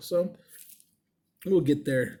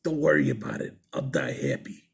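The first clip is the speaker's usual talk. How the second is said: put-on much lower, growly old-man voice; old Brooklyn man; 11 semitones lower than usual. Old husband answering the worried piercer, low Brooklyn growl. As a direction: rasp it out like an ancient Brooklyn husband. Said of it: "just had this old Brooklyn accent".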